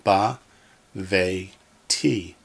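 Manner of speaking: "ba, ve, ti" in three separate syllables. Shown as a demonstration. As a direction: pause between ba, ve and ti. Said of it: "we have three syllables".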